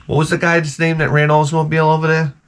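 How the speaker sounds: usual speaking voice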